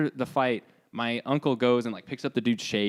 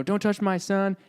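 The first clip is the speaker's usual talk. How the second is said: higher-pitched, lightly voiced mother's warning; mild retold yell; 7 semitones higher than usual. Son retelling his mom's warning, mild mock yell. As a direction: quote mom's yell at ordinary volume. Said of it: "and she's yelling".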